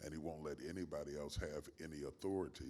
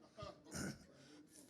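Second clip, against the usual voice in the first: soft laugh; 7 dB quieter than usual. Real, not acted. Host's remark makes him laugh.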